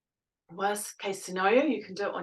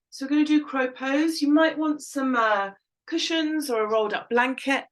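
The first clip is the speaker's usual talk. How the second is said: raised, carrying instructor voice; projecting loudly; 5 semitones higher, 6 dB louder than usual. Real, not acted. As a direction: call it out over the room. Yoga teacher cueing the class.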